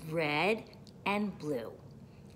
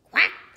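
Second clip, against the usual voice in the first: loud, short quack; duck quack; 15 dB louder than usual. A narrator's reading. Duck greets the truck, loud quack. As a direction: punch out a duck's quack. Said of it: "said a duck".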